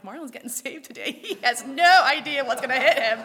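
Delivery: barely holding back laughter at a happy secret; laughing. Retold or imitated. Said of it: "I'm kinda smiling behind my hand".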